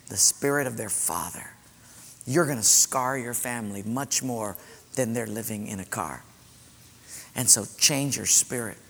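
soft, low, steady rebuke; calm firm counsel. Retold or imitated. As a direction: settle him down quietly but firmly. Pastor calmly confronts angry man.

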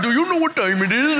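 put-on quick East Indian-accented question, rising. Phrase haunting conference speaker, East Indian accent. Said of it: "morphed from an East Indian accent".

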